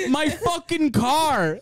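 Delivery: slow, high-pitched mock dismay; aggrieved driver. Driver seeing his smashed window, mock dismay. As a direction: draw it out in imitated outrage. Retold driver upset about car.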